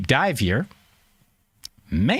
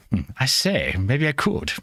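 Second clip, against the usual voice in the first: put-on a brief, playful British accent; mock British accent. Streamer asked to do the show in that accent, mock British. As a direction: slip lightly into a British gent. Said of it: "in that accent".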